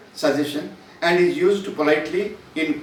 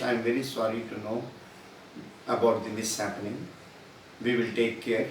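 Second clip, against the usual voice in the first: softer, sincere condolence; sympathetic manager; 4 semitones lower, 6 dB quieter than usual. Pretend. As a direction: gently console the worker. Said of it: "what he says is".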